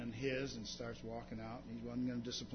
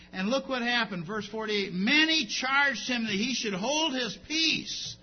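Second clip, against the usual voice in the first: ordinary talk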